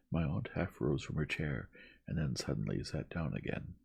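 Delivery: plain reading voice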